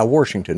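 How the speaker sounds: "Washington" said as "Warshington"; extra R. Real, not acted. Answering a friend's teasing, "Washington" with an extra R. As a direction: put an R before the SH in "Washington". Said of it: "you put extra R's in your words".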